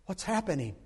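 normal talking voice

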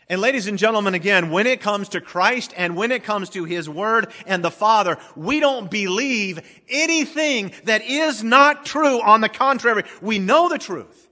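loud, high, driving sermon delivery; full-force preaching. Real, not acted. Preacher to congregation on Christ and His Word, full-force preaching.

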